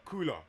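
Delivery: "cooler" with a clearly sounded L; L fully pronounced. Real, not acted. Friend chatting about cool mornings, L fully pronounced. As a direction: say "cooler" with an audible L in the middle. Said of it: "Why are you saying it like that".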